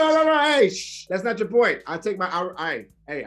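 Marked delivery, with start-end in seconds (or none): 0.1-1.1 high, loud shush to cut him off; sharp hush. Real, not acted. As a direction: silence the interrupter with a loud shh. Cutting off an interrupting co-host.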